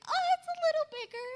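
mock sheepish squeak; sheepish admission. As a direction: blurt the confession up high, embarrassed. Sheepish confession, very high.

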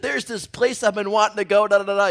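loud, high, excited patter; eager suggestion. Retold or imitated. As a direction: pitch the restaurant loudly and eagerly. Eagerly picks the restaurant.

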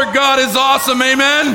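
loud call to the crowd; shouting. Real, not acted. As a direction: shout it out to the room. Pastor calls out to congregation.

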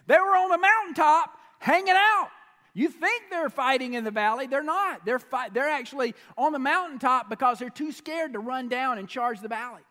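loud, high insistence; preacher pushing back at the dad; emphatic retort. Acted. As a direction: insist hard that they're hiding. Continues the retort.

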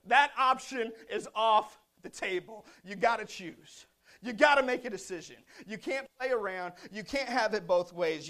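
loud, emphatic preacher; forceful preaching. Real, not acted. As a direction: preach it hard, driving each phrase home.

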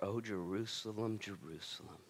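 soft, slow, grieving cry of the city's name; mournful lament. Acted. Jesus grieving over Jerusalem, soft and slow. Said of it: "he'll literally break tears".

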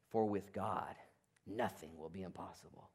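low, soft, falling solemn; hushed promise. Retold or imitated. Angel assuring Mary, hushed and solemn. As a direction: lay it down gently like a promise.

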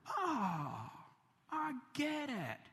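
sudden high realization, voice lifted; the penny drops, wide-eyed; aha moment. Retold or imitated. Listener realizing at last, high and wondering.

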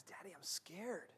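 put-on whispered, frightened little girl; softly voicing a scared daughter; scared child. Daughter at the rope bridge, whispered fear. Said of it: "And she goes".

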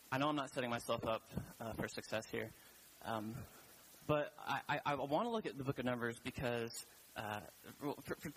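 ordinary talk

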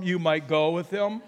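normal talking voice

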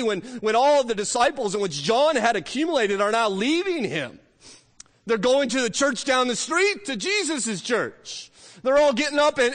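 full-voiced pulpit storytelling; fervent preaching. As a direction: drive the story of the leaving disciples home.

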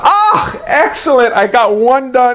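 high-pitched, relieved little cheer; elated relief. Retold or imitated. Student finishing his first evangelism assignment, elated with relief. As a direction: act out delighted relief, starting very high. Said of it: "out of great relief".

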